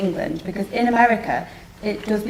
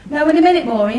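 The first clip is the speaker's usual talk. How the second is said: raised, higher call across the kitchen; 6 semitones higher, 6 dB louder, faster than usual. Acted. Mag calls after her daughter in a raised voice.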